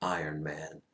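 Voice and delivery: understated hero's last line. Retold or imitated. Tony answering Thanos, low and quietly defiant. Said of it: "he goes".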